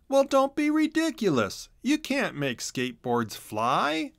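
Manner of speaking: a hint of big-brother dismissal; scoffing. A narrator's reading. Chisel scoffs.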